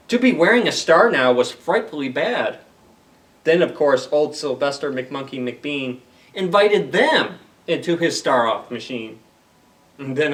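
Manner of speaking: animated storybook voice with bouncy rhyme; reading to children. Acted. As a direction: perform the rhymes for a young audience. Reads Seuss story aloud.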